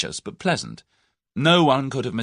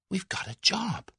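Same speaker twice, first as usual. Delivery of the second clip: hushed, thrilled whisper; slow, high awed whisper; 12 semitones higher, 4 dB quieter than usual. A narrator's reading. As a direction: breathe it as if it's too good to be true.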